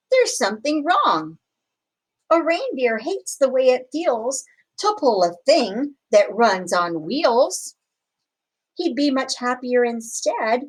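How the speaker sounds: ordinary delivery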